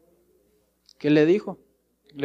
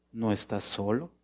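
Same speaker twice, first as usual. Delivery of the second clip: soft, lower and comforting; tender reassurance; 4 semitones lower, 7 dB quieter than usual. Retold or imitated. God comforting a lonely believer, soft and tender. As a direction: say it like holding someone close.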